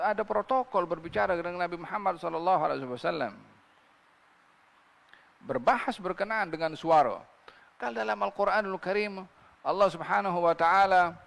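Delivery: ordinary talk, nothing marked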